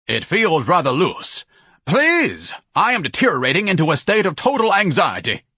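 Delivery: high-pitched, pompous alarm; theatrical panic in lofty diction; pompous Ignatius, anxious. Acted. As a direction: fret grandly while being hoisted. Ignatius panics while being lifted.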